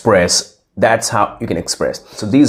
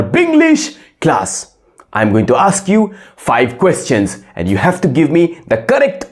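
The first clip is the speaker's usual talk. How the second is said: higher-pitched strict teacher addressing his student; 4 semitones higher than usual. Acted. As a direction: play the classroom teacher welcoming a returning pupil. Teacher role in classroom skit.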